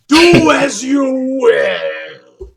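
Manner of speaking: very slow, loud, breaking into laughter; laughing proclamation. Acted. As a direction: boom it while laughing.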